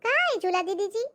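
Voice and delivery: high, wide-eyed maid; naive maid voice. Acted. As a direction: play the simple-minded maid. Maid repeats her question.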